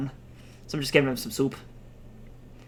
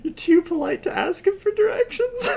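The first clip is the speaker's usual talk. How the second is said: laughing disbelief; 4 dB louder than usual. Real, not acted.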